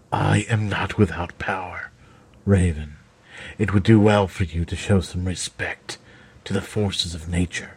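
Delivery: Kennel, menacing. A narrator's reading. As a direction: warn her, low and cold. Kennel's voice in read story.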